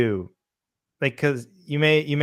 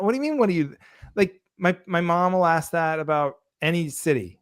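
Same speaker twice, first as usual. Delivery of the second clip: ordinary talk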